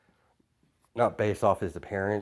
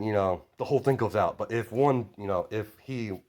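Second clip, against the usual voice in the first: ordinary delivery, nothing marked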